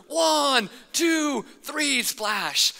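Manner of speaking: high called-out count with a flat splash. Retold or imitated. Counting from the slide top, called out high. Count heard again, closer.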